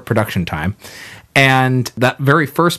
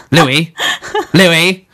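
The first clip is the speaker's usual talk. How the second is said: high, loud calling out Louis; a wife calling for her husband; nagging wife calling; 7 semitones higher, 4 dB louder than usual. Acted. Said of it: "seances that we sometimes do where I channel history".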